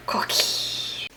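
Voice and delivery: soft whisper. Acted. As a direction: whisper the word lovingly. Whispered cookie.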